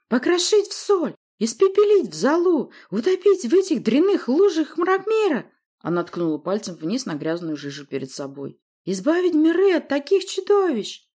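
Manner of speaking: high, loud ranting witch; creaking witch. A narrator's reading. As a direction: screech out each threat. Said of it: "проскрипела колдунья".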